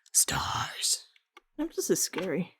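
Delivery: breathy whisper of a word; whispering while drawing stars. Real, not acted. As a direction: whisper it to yourself. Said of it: "unvoiced whisper".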